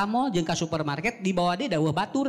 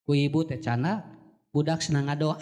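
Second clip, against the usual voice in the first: ordinary talk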